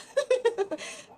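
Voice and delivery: quick high amused laugh; a bright little chuckle; short laugh. Real, not acted. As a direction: laugh at your own joke. Laughs at own joke.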